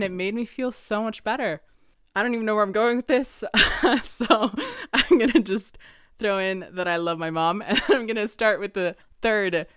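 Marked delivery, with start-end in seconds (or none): 2.1-9.7 fast, loud laughing ramble; laughing at her own rambling. Real, not acted. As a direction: giggle through the tangent.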